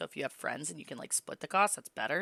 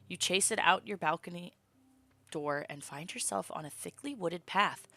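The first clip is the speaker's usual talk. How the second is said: ordinary talk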